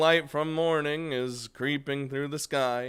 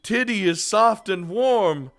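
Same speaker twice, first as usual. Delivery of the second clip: higher, louder mock-grand pronouncement; mock profound; 8 semitones higher, 8 dB louder than usual. Real, not acted. Mock philosophical riff.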